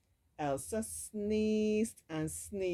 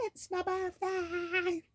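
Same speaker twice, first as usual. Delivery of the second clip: high, slow, thrilled cry; excited shout; 14 semitones higher, 4 dB louder, slower than usual. Acted. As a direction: shout it with joy. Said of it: "she shouted excitedly".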